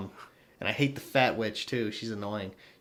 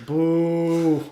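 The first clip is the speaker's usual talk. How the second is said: long, loud, high boo; drawn-out mock boo; playful booing; 6 semitones higher, 8 dB louder than usual. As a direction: jeer it like a heckler. Jeering after mock apologies.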